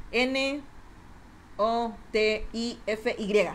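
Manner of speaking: slow, letter by letter: N, O, T, I, F, Y; spelled letter by letter. Shown as a demonstration. Streamer spelling the command for chat, slowly letter by letter. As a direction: spell out Notify one letter at a time. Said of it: "O sea, Notify".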